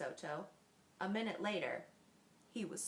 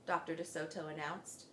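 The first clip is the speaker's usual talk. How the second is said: usual speaking voice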